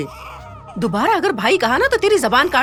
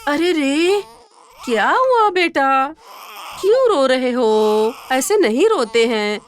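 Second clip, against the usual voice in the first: put-on slow, tender cooing to a baby; tender comforting; slower than usual. Mother lovingly soothing her crying baby son, slow and tender. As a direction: hush the baby gently, slowly.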